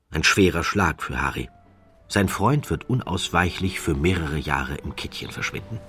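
ordinary delivery, nothing marked

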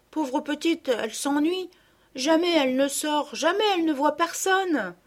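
put-on a young girl sorry for her lonely doll; pleading little girl. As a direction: whine a little for your doll.

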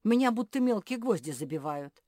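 high, pained outcry; squealing. A narrator's reading. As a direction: wail as if hurting. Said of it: "взвизгнул Ричард".

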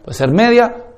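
normal talking voice

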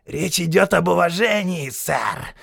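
высокое злобное шипение сержанта; прошипеть над умирающим. A narrator's reading. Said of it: "прошипел сержант". (сержант шипит, склоняясь над жертвой)

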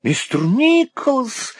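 high, slow, drawn-out cry of surprise; astonished cry. A narrator's reading. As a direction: jump up in delighted amazement. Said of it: "вскричала мисс Лакриви". (изумлённо вскрикивает, высоко и протяжно)

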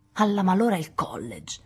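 a touch of an impatient grumble; lightly voiced annoyed grumble. A narrator's reading. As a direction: mutter it with mild impatience. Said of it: "brontolò Lori con un sospiro di impazienza".

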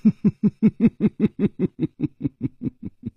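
一长串断续轻快的笑声，渐渐收弱; 笑上好几秒，越笑越轻. A narrator's reading. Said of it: "微微一笑". (偏高的连串轻笑)

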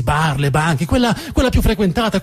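ordinary talk, nothing marked

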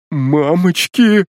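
slow, high terrified murmur; frightened babble. A narrator's reading. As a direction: stammer in fear. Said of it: "пролепетал Кекс". (испуганный лепет)

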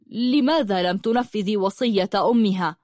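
usual speaking voice

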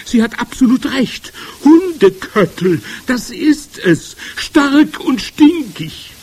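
high, loud, drawn-out witch cries; calling out. A narrator's reading. Second witch confirms the stink, calling out. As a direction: cry it slowly, stretching the smelly words. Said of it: "rief eine zweite Stimme".

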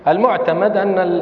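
ordinary talk, nothing marked

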